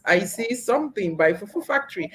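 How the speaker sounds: normal talking voice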